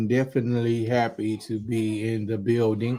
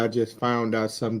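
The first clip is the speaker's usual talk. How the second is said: ordinary delivery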